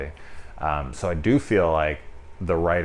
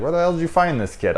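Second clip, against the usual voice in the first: high, fast exasperated imitation; 10 semitones higher, faster than usual. Man complaining about the kid, fast exasperated mock. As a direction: toss it off in disbelief. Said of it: "he's like".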